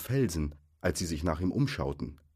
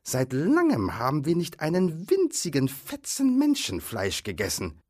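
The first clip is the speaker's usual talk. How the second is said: higher, louder complaining troll; lightly voiced indignant troll; hint of grumbling troll; 9 semitones higher, 3 dB louder than usual. A narrator's reading. Troll complains about no Menschenfleisch, grumbling.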